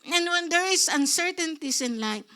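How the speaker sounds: ordinary talk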